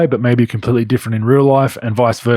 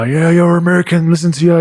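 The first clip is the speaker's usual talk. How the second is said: mocking mates; 5 semitones higher than usual. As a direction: rib him for sounding American. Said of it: "they're just breaking my nuts".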